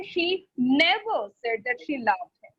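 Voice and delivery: never punched with a pitch swell; stress on never. Shown as a demonstration. Teacher demonstrating intonation word by word, stress on never. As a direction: lean hard on the word "never". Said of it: "If I say".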